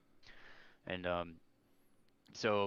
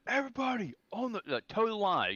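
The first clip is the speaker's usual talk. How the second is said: drill sergeant yell; 12 semitones higher than usual. Retold or imitated. Recruit retelling the sergeant at 2 a.m.: high mock yell. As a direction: play the drill sergeant storming in, calling everyone up.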